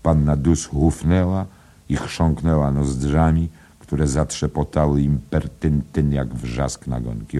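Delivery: ordinary delivery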